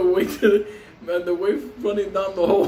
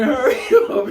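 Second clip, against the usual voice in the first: louder, higher mock yell breaking into laughter; retold screaming; 5 semitones higher, 5 dB louder, faster than usual. Trapped friend screams to his rescuers, laughing mock yell. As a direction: holler for help through a laugh. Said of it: "he was screaming".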